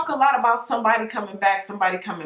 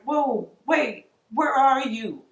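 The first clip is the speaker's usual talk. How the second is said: a sudden higher "wait, where'd you go"; startled dream energy; 5 semitones higher, much slower than usual. Pretend. Energy noticing the dreamer disconnected, surprised and higher. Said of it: "It's like".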